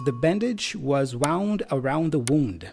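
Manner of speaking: first "wound" rhymes with found, second "wound" says woond; contrasting pronunciations. Shown as a demonstration. Reading a confusing example to learners, contrasting pronunciations. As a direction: say "wound" as wownd, then "wound" as woond. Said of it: "confusing words when it comes to pronunciation".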